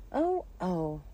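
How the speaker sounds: plaintive moans of dread; groaning cries. A narrator's reading. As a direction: moan in anticipated pain. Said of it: "the poor Queen groaned out".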